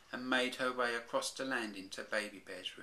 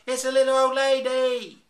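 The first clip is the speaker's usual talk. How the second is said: much higher, much louder shriek; screamed; 18 dB louder than usual. Acted. The bears seeing the old lady, screaming in terror. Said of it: "This is what really happened".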